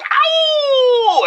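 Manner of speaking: very high howled ow like a dog. Acted. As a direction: howl it out like a hound baying at the moon. DJ howls after his name.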